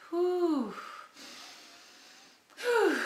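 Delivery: long high whooshing breath out; calming exhale. Real, not acted. Calming herself on camera with a long whew.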